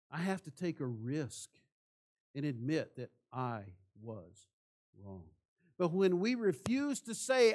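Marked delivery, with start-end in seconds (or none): 3.3-5.3 reluctant admission. Shown as a demonstration. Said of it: "It is hard to say".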